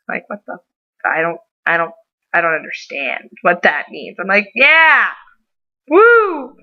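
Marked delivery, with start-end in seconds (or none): 4.6-6.6 mock cheer.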